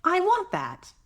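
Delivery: high, eager little sister voice; little girl wanting. Acted. Sister Kay points at the cat, high eager want. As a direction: pitch it way up like a small girl. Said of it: "and she said".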